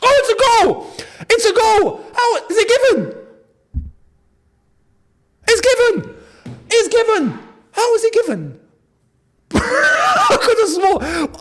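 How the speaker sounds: high, loud stunned shouting; shocked joy. Real, not acted. Fan hearing the goal was given after all, stunned joyful shouting. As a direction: shout in joyful disbelief that it stands.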